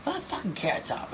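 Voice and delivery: mock Chris Rock ribbing him; Chris Rock impression. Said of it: "he said".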